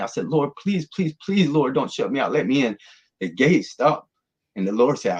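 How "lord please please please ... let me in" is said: high, urgent pleading, reliving it; retold desperate plea; begging. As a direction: beg repeatedly, starting high and easing down. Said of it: "I'm begging".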